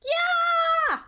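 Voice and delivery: high, loud sustained shout; piercingly high "yaah" cry; sudden yell. Acted. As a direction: yell it out in one long burst. Sudden loud yell during play.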